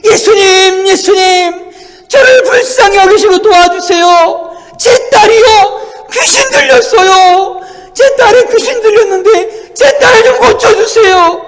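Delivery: loud, high-pitched desperate pleading; crying out. Acted. Foreign woman begging Jesus to heal her daughter, crying out. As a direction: wail it at full voice like a begging mother. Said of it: "달려와가지고 소리치는 거예요".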